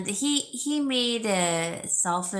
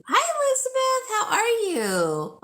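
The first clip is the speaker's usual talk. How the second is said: excited greeting; 4 dB louder than usual. Real, not acted. Greeting a viewer in live chat.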